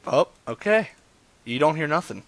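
normal talking voice

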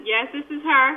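distant speakerphone voice. Real, not acted. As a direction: sound far from the phone mic. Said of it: "almost as if you're on speakerphone".

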